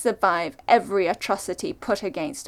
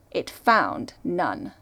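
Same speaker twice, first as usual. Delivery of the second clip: usual speaking voice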